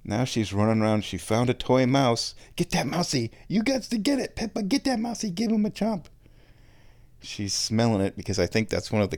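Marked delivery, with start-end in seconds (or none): 2.5-6.1 pet talk. Real, not acted. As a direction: egg the kitty on, sweetly. Voicing encouragement to the cat.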